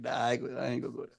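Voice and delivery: very soft, low self-restraint; backing down. Retold or imitated. Answering his own mind, softly backing down. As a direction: talk yourself down quietly. Retold inner decision not to fight.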